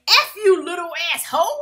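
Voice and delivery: hammed-up angry outburst; mock angry cussing. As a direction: spit it out like a mean mama. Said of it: "Mama Joyce is like".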